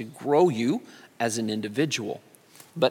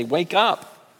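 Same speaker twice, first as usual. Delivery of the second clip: mock urgent plea; 5 semitones higher, slower than usual. Preacher voicing the church shaking the Lord awake, mock urgent. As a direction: shake him awake with mounting urgency. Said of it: "we want to shake the Lord and say".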